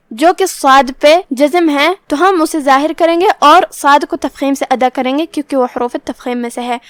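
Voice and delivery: ordinary talk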